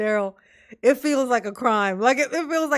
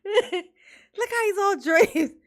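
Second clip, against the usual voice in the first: laughing; 8 semitones higher, much slower than usual. Real, not acted. Laughing at defendant.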